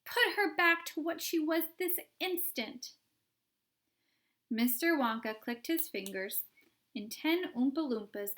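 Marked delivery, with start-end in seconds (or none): 0.0-2.9 demanding mother. A narrator's reading. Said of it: "yelled Missus Beauregard".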